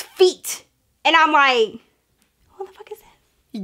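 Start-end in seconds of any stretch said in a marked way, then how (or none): 2.6-3.6 tiny, high, hushed disbelief; shocked whisper. Retold or imitated. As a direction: whisper it under your breath in mock shock. Shocked at seeing feet, retold.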